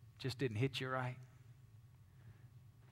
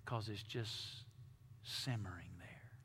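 whisper; 3 semitones lower, 7 dB quieter, slower than usual. Shown as a demonstration. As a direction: drop to a hushed whisper. Describing anger simmering inside.

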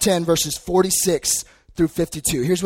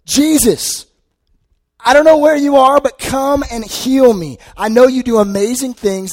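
desperate cry; 8 semitones higher, 7 dB louder than usual. Retold or imitated. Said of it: "and he goes".

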